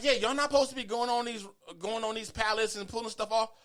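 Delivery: high-pitched mock telling-off; retold cashier's scolding. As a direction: imitate a cashier reprimanding a customer.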